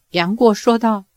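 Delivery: ordinary delivery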